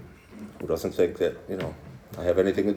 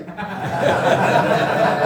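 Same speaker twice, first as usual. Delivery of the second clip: laugh; 13 semitones higher, 5 dB louder than usual. Real, not acted. Laugh at daughter's call.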